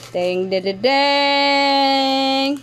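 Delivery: playful drumroll-style reveal jingle; sung "ta-da" fanfare. Pretend. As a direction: sing out a little reveal fanfare. Vocal fanfare before revealing gift.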